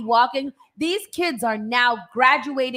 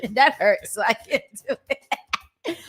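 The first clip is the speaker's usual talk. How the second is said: laughing. Real, not acted. Laughing after straining voice.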